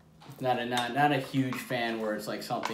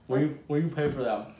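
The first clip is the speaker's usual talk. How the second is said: talking with a mouth full of ice cream; 5 semitones higher, 6 dB louder, faster than usual. Real, not acted. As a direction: speak through a mouthful. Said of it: "Excuse my eating with my mouth full".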